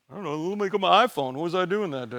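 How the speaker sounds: a distracted person fumbling for their phone; clueless listener. Imitating the congregation checking their iPhone, fast and vague. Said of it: "most of you are gonna go".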